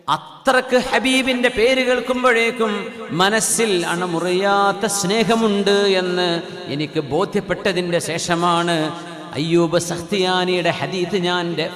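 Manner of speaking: firm, projected sermon cadence; impassioned preaching. Acted. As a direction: preach it out, leaning on the phrase endings. Preaches at force.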